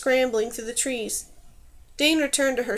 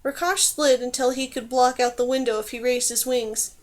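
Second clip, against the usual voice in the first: ordinary delivery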